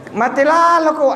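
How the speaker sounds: mock yell. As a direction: yell it at the passer-by as a joke. Mock curse shouted again.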